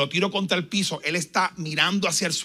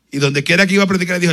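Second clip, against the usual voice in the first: ordinary delivery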